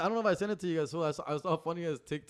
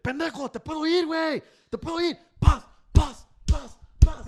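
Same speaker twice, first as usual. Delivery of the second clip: higher and louder furious yell; angry singer cursing; 8 semitones higher, 8 dB louder than usual. Retold or imitated. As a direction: snap at the engineer in a rage.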